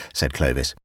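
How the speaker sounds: ordinary delivery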